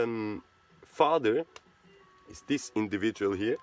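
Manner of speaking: ordinary delivery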